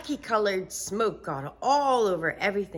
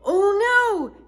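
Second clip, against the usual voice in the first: high loud oh no; alarmed little boy; dismayed; 12 dB louder than usual. Acted. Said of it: "said Michael".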